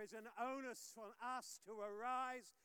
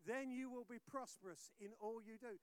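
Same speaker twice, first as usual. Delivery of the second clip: ordinary talk, nothing marked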